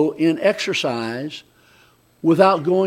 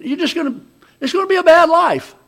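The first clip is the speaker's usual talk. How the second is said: mock warning; 11 semitones higher, 6 dB louder, faster than usual.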